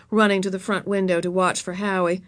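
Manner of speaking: ordinary delivery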